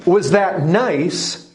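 Was that nice drawn out slowly and pointedly; slow pointed question. Shown as a demonstration. Preacher repeating a parent's question, slow and pointed. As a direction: lean hard on was, slow. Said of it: "You, you see".

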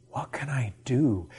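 soft, whispered wondering; hushed question. Acted. Preacher voicing a willing believer's question, hushed whisper. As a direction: ask it quietly, under the breath.

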